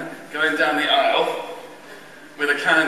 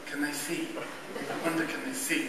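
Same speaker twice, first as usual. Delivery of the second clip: much softer worried murmur; anxious inner question; 11 dB quieter than usual. Retold or imitated. Muddy choirboy wondering if people notice, hushed. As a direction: wonder it under your breath. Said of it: "my big question was".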